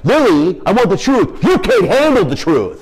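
high, loud hammed-up movie shout; kid-mimicking A Few Good Men, laughing; mock courtroom yell. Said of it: "like Jack Nicholson in A Few Good Men".

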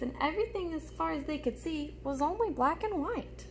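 put-on lively storybook reading for little kids; reading to children. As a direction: read it like story time for a class. Reads picture book aloud.

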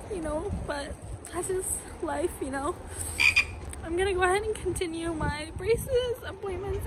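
high, teary voice, soft and wavering upward; emotional, close to tears. Real, not acted. Leaving her orthodontist office, real teary high voice. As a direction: sad to leave the kind orthodontist staff. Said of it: "so I'm currently emotional".

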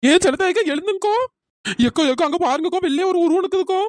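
high, urgent wake-up call; alarmed calling to sleeping friends; frightened. Acted. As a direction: plead for them to look, pitched high. Urgently wakes friends to see figure.

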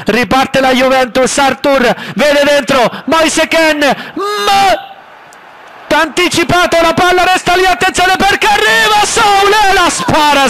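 high, climbing play-by-play call; breathless italian goal commentary. Acted. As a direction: ride the attack up to a shout. Live play-by-play building to peak.

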